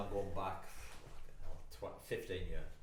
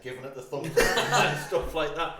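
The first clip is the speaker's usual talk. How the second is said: loud, higher, breaking into laughter; laughing; 7 semitones higher, 14 dB louder than usual. Real, not acted. Laughing while describing the prank photos to mates.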